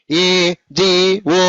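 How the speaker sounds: ఏజీఓ slow, each letter apart; divided letters. Shown as a demonstration. Teacher showing pupils what not to say, ఏజీఓ slowly divided. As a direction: break ఏజీఓ up with gaps between letters. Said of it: "అనరాదు".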